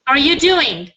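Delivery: "doing" falls at the end; teacher's demo, pitch dropping through "doing"; falling intonation. Shown as a demonstration. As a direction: model a clear downward glide on "doing". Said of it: "with your intonation going down".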